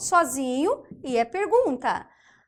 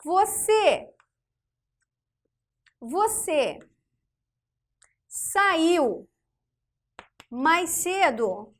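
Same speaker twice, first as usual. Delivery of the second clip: slow dictation; 3 semitones higher, much slower than usual. Shown as a demonstration. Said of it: "Olha só o exemplo".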